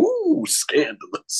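movie trailer voiceover. Retold or imitated. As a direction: stretch it out like a cheesy film promo. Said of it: "it would just stop and go".

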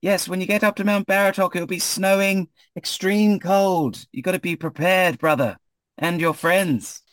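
high, sustained character voice; high-voiced brotherly adviser. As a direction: call out advice in a high, held-up voice. Voices Rahadin advising travellers.

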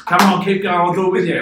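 loud, high pep-talk coach voice; coach egging on. Retold or imitated. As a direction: shout it like a coach pushing a runner on. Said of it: "You gotta coach going".

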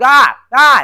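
imitating a toddler's high, loud scream; mock toddler scream, high and loud; child's scream. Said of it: "and he starts, like, screaming".

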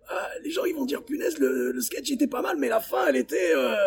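high, half-whispered and thin; small strained voice. Retold or imitated. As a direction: squeeze words out of a sore throat. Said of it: "je parlais vraiment avec une petite voix".

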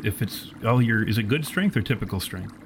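normal talking voice

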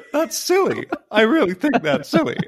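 very high silly voice, laughing; mock indignant objection. Podcaster carrying on the parody with co-host, mock indignant objection. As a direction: object in a squeaky put-on voice.

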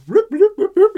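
high, loud rapid wand beeps; imitated wand noise. Agent running up waving his wand, high loud imitated noise. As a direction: mimic the little wand going off. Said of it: "He makes a little noise".

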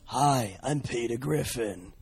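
strange impression. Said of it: "do an impression show".

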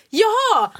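it dawns on you all at once; sudden realization. Retold or imitated. Caught at the wrong house, friend blurts a high jaha. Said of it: "Du bara".

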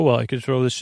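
ordinary talk

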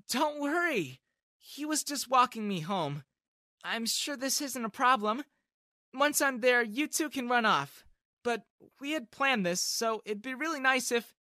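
lightly voiced sweet girl; polite, a hint of sweetness. A narrator's reading. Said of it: "with a sweet comment".

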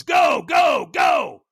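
loud, high trainer's yell; shouted go command. Retold or imitated. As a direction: launch someone with an urgent shout. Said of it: "And the trainer goes".

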